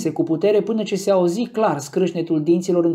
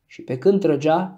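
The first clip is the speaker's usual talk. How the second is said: ordinary delivery, nothing marked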